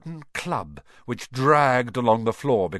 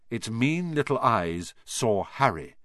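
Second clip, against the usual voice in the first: ordinary delivery, nothing marked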